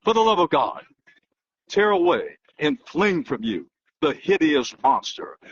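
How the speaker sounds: ordinary talk, nothing marked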